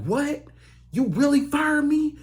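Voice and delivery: shocked disbelief. Retold or imitated. Dumped man to his ex, high shocked disbelief. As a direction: gasp it out in disbelief. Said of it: "now he like".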